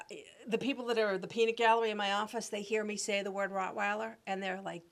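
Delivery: ordinary talk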